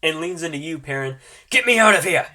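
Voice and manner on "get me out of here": higher, louder desperate plea; frantic plea. Acted. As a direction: beg to be freed. Legolas pleading frantically.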